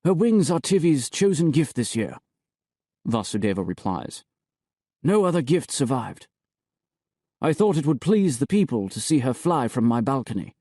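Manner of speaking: a hint of the high priest, explaining; high priest justifying himself, lightly voiced. Vasudeva voiced in the story.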